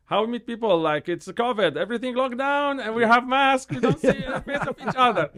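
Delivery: laughing through the frustration; exasperated laugh. Real, not acted. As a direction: throw up your hands at how impossible it was. Exasperated laughing complaint about covid.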